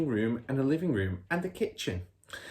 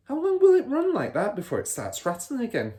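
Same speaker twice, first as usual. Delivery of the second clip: put-on much higher, pointed child's question; Matilda's voice; 5 dB louder than usual. Matilda questioning her father, high girlish. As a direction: ask innocently but sharp.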